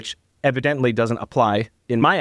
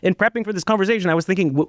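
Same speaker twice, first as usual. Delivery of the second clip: ordinary delivery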